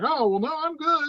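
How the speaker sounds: high, singsong mock nonchalance; retold breezy dismissal. Patient told he should be having a stroke, airily dismissive.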